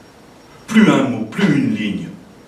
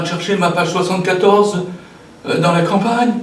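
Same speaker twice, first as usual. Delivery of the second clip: higher, louder mock alarm; affolement imaginé; 6 semitones higher, 5 dB louder than usual. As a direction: act out a mounting panic at the imagined uproar. Imagines the panic on the train. (affolement joué, voix plus haute et forte)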